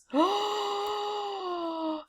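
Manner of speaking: sharp high gasp of delight; excited gasp. Real, not acted. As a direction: gasp with glee. Delighted at spoonerism theme.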